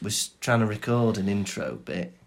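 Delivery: ordinary talk, nothing marked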